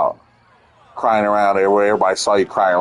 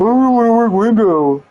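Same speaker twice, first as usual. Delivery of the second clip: mock crybaby voice, pitched way up; high mocking whine; whiny crybaby; 11 semitones higher, 4 dB louder than usual. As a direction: imitate a kid whining in protest, high and loud-ish. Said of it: "like a crybaby, man".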